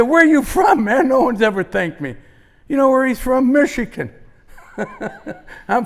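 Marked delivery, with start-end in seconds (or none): 0.0-2.2 high, loud astonished question, easing off; surprised. Retold or imitated. Mailman to a stranger who thanked him, surprised. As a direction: retell a mailman's amazement.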